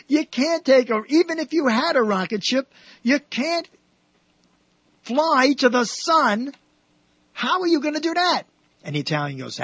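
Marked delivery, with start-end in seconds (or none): 0.1-8.4 high-pitched, disbelieving challenge; mock astonishment, voice pushed high; incredulous objection. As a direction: protest the absurd plan, pitch climbing. Crowd challenges Italian's sun plan.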